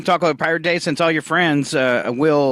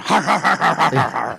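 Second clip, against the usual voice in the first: pirate laugh. Shown as a demonstration. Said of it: "It's a pirate's laugh".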